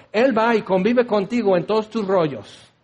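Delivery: normal talking voice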